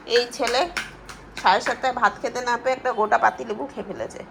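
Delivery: ordinary talk, nothing marked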